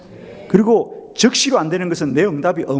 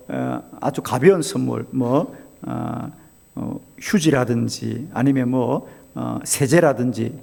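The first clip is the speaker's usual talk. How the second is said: normal talking voice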